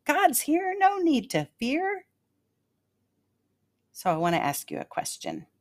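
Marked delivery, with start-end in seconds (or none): 0.0-2.0 put-on very high, lilting little-boy voice; five-year-old boy. Five-year-old son to his mother, imitated child's reassurance. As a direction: imitate a small child sweetly reassuring mum. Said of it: "that little five-year-old boy just kinda looked at me and said".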